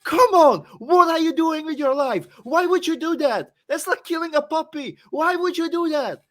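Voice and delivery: loud, high, exaggerated disbelief; mock outrage. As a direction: rant like it's a crime against vodka. Mock outrage at mixing vodka.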